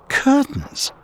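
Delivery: ordinary delivery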